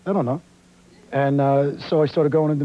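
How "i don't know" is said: lightly acting out his younger self deciding to lie; mock resolve. Actor retelling his decision to fake a New York origin, mock resolve. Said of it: "finally, one day I just said".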